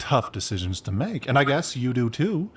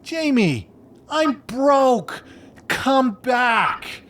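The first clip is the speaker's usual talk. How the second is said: much higher, loud begging cry; pleading call; 12 semitones higher, 4 dB louder, much slower than usual. Acted. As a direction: wail at them to come back. Pleading for friend to return.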